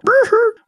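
very high, short puppet giggle; Yoda. Retold or imitated. Yoda chuckling at Luke, high little laugh. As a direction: imitate a little creature's squeaky laugh. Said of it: "Which I love his little laugh".

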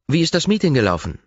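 a brisk, curious assistant; Ross's voice. Acted.

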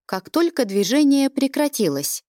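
plain reading voice

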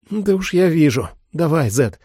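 lightly voiced woman; woman's voice. Holly speaks.

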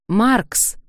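high, loud call across the rubble. Acted. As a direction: shout the name to find her. Calling out after fall.

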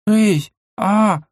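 high, nervous startled question; flustered and nervous; nervous girl. A narrator's reading. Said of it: "как-то нервно сглотнув, спросила Мила". (нервный высокий голос)

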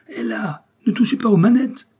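high, loud alarmed yell; shout. A narrator's reading. Driver shouting at men driving off in his car, loud high yell. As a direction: shout a frantic warning at the thieves. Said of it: "cria ma pipe".

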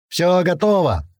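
a moderate narrated shout; called out. A narrator's reading. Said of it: "крикнул Клёпа".

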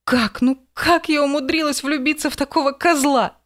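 high despairing cry; exclaiming. A narrator's reading. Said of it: "воскликнула она".

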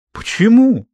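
high, drawn-up protesting question; indignant disbelief. A narrator's reading. Girl cannot accept the boy's refusal, indignant. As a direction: protest it, pitched sharply up.

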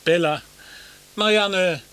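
loud, high call across the water. Acted. Balduin calling after the women on the river. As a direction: shout their names after them.

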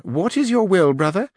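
a stiff duenna introducing herself; old duenna answering Sancho, high and prim; haughty old duenna. Acted.

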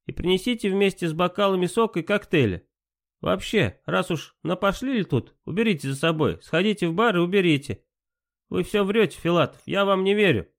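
imperious plummy grande dame. Acted. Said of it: "надменно произнесла Малиновская".

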